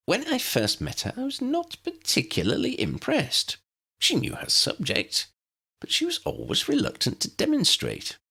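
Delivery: put-on high, admiring old wizard voice; small wizard, impressed; eager little professor. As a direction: pitch it up and marvel. Flitwick voice.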